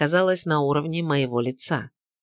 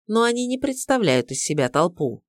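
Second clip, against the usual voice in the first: ordinary delivery, nothing marked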